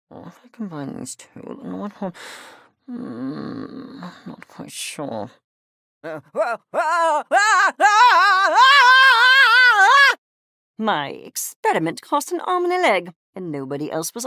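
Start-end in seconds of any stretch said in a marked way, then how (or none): 0.1-5.3 low, soft thinking aloud; muttering to oneself. Acted. Thinking aloud over an experiment. 6.0-10.1 cries climbing to a loud, high peak; building cry. Acted. Cry building after an experiment.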